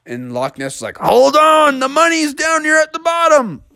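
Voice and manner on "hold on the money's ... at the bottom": high, eager monster; urging him onward. Acted. Monster answers mid-dive.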